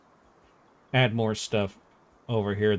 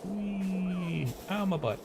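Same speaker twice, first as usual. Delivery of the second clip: tripping and falling; 8 semitones higher, 5 dB quieter, much slower than usual. Real, not acted. Character slides and falls.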